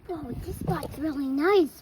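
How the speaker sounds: ordinary delivery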